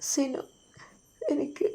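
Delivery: soft broken words through tears; words that break off, weak and tearful; crying wife calling her husband's name softly; faint tearful whisper. Acted.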